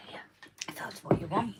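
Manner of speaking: hushed, unvoiced whisper; real whisper, barely voiced. Real, not acted. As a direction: whisper a grudging-free aside. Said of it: "even though you're whispering".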